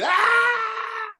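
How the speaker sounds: imitating a five-year-old's high wordless scream; high, piercing mock child scream; child's scream. Preacher imitating a screaming child, high mock scream. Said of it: "five-year-old screaming".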